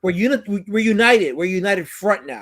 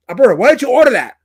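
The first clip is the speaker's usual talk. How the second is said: loud, high mock scolding; hammed-up motherly yell; pissed-off mom; 5 semitones higher, 11 dB louder, faster than usual. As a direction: imitate an angry mother demanding an explanation. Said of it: "my mom was pissed".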